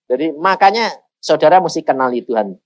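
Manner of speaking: usual speaking voice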